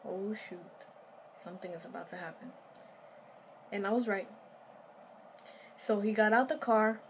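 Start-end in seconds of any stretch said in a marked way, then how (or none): 0.1-2.5 scared hush. Retold or imitated. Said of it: "So I'm like".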